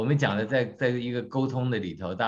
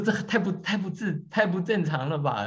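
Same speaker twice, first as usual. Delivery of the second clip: much higher, hammed-up protest; mock protest; 8 semitones higher than usual. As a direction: squeak it out like a wounded husband. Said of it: "我说".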